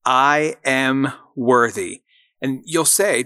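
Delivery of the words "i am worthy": slow, deliberate, every word weighted; slow affirmation. Shown as a demonstration. Host leading listeners in an affirmation, slow and deliberate. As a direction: lay it down slowly so they can repeat it. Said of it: "I gotta hear you say it".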